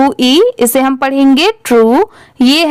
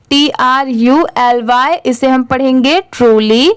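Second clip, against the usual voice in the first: usual speaking voice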